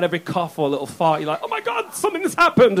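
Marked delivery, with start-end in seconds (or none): 1.4-2.8 mock panic, much higher and hushed; panicked parent. New dad hearing a cough on the monitor, mock-panicked and hushed. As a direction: gasp it out like a terrified new parent. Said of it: "both of you are running upstairs crying".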